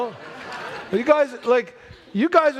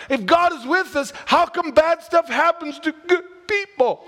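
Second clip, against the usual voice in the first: frightened Gideon, higher; 8 semitones higher than usual. Retold or imitated. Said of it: "And he's like".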